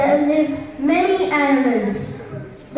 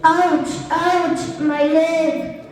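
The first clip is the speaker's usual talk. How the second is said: ordinary delivery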